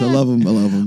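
ordinary talk

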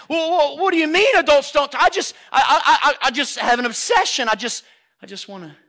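high, loud stammering protest; flustered child. Acted. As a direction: stumble over the words like an eager kid. Acted child protesting to the adult.

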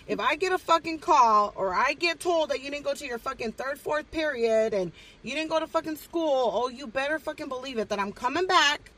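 loud, high-pitched acted ultimatum; threatening tirade. Guardian threatening brother at school drop-off, loud acted tirade. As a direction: lay down the law at full volume, drawing out each threat.

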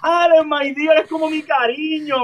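talked through laughter, high; laughing explanation. Retold or imitated. Stepmother explaining the phrase, laughing hard. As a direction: explain while still laughing. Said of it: "mi madrastra".

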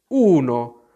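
affronted sleepy-creature voice; indignant. A narrator's reading. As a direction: huff it out, dragging the words. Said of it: "disse il Ghiro indignato".

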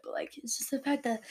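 ordinary talk, nothing marked

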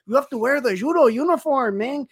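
put-on a light Mexican accent, pitched a bit up; playful spoof of a Mexican martial arts teacher's accent. Retelling a spoof judo lesson to his co-host in a light Mexican accent. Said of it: "with the accent you're giving".

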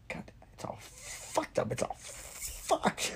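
high, whispery strained squeal, drawn out; imitated buddy's outburst. Showing how Mike used to swear, strangled and squeaky. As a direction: squeeze it out slowly with hardly any voice. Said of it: "he would say".

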